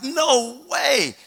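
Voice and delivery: high, drawn-out astonishment; high-pitched incredulous reaction; amazed disbelief. Retold or imitated. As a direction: re-enact being floored by the news. Learns who the man is.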